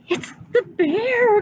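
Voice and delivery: frightened cry. A narrator's reading. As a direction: cry out in fright as a small boy. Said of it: "cried Eddie".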